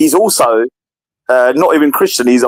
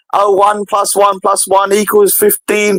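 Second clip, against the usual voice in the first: higher, slow mocking sing-song; mocking quote; 7 semitones higher, slower than usual. Preacher [name] mocking the Trinity, slow sing-song ridicule. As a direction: drag it out to ridicule. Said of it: "blah, blah, laughing".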